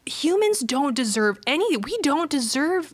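put-on high-pitched, distressed little-girl plea; imitated heartbroken child. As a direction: mimic a child broken up over this. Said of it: "Kirby is just broken".

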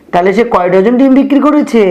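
usual speaking voice

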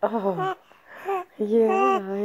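normal talking voice

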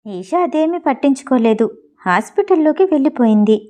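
usual speaking voice

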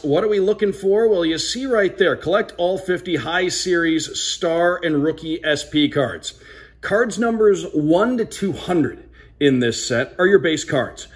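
normal talking voice

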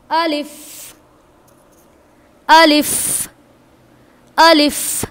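अलिफ loud and short; अलिफ called out firmly; loud teacher demo. Shown as a demonstration. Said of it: "जब हम pronounce कर रहे हैं". (ज़ोर से अलिफ)

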